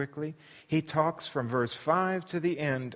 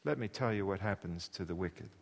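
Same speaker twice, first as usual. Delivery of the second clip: quiet taunt; 6 semitones lower, 7 dB quieter than usual. Acted. Bildad softly repeating.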